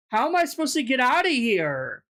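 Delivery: usual speaking voice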